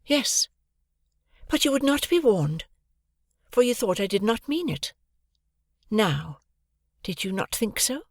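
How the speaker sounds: calm and unmoved; Estella's voice. A narrator's reading. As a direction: Estella coolly pressing Pip. Estella's voice, much higher.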